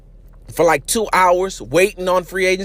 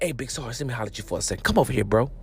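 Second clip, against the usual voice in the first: low, much softer conspiratorial voice; mock whisper; 7 semitones lower, 9 dB quieter, much faster than usual. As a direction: pull him aside in a hushed voice. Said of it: "be like".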